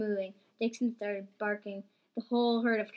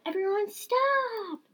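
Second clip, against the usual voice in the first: high, loud, drawn-out shout; stretched yell to stop everyone; furious yell; 13 semitones higher, 6 dB louder, slower than usual. A narrator's reading. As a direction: bellow a long furious halt. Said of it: "and yelled".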